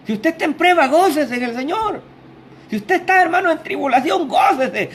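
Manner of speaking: high, ringing call to rejoice; fiery preaching. Acted. Preacher urges the suffering congregation to rejoice, in fiery preaching. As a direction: lift the congregation up, preaching hard.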